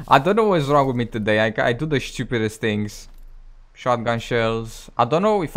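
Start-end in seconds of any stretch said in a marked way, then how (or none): none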